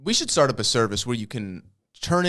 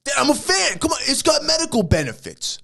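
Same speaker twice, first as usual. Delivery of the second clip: put-on pleading rich kid; 13 semitones higher than usual. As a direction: beg your father, voice shooting up.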